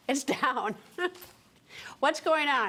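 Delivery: laughing. Real, not acted. Host laughs at the bizarre deletion.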